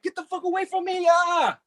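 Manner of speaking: high-pitched, recoiling squawk; acted yelp, pushing someone away; strained high cry, fending someone off; startled reaction. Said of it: "And it's like".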